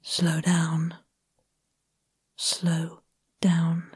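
low, soft, slow "Slow down"; slowing right down. Retold or imitated. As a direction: spell out the advice in slow motion. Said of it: "She says".

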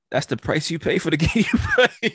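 high, breaking into laughter; laughing verdict. Real, not acted. Host sums up friend's toothbrush mess, laughing. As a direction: crack up halfway through the line.

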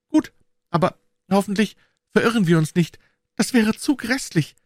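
put-on high, anxious girl's voice; a scared little girl asking to turn back; timid high girl pleading; frightened girl. A narrator's reading. Narrator voices Becky.

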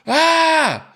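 high, loud "ah!" yell; retold scream. Moviegoer reliving the end of the film, retold scream. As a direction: let out a sudden high scream, retelling it. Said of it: "I screamed, like, right before the credits rolled".

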